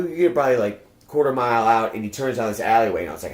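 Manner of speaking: usual speaking voice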